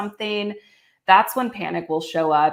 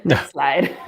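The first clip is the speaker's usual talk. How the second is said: laughing; 5 semitones higher than usual. Real, not acted. Amused echo of the interruption.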